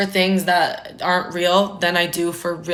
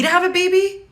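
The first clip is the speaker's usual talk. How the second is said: incredulous; 11 semitones higher, 3 dB louder than usual. Real, not acted. Incredulous at marrying young.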